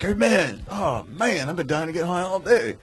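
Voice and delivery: high, eager stoner; stoner pool guy. Retold or imitated. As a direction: pitch up, a desperate stoner. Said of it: "He's like".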